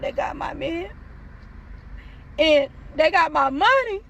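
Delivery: mock wail, pitch rising on the ends; hammed-up tearful lament; retold crying. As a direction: whine it out, dragging the words. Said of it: "I'm just crying".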